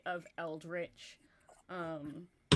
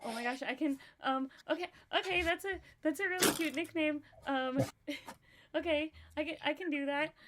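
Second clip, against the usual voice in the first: higher, giggly, tripping over words; flustered; 6 semitones higher than usual. Acted. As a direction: stammer through a sudden crush. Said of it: "she got so flustered".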